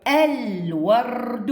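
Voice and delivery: الورد stretched slowly with ال fully voiced; very slow reading. Shown as a demonstration. Teacher models ال at sentence start for pupils, very slow. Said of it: "شوفوا شلون أقولها".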